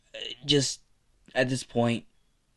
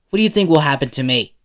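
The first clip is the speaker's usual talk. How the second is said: loud, fast, raised demand; heated confrontation; 4 semitones higher, 9 dB louder, faster than usual. A narrator's reading. Izuku grabbing Bakugo's shirt, heatedly confronting him. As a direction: press him hard with the question.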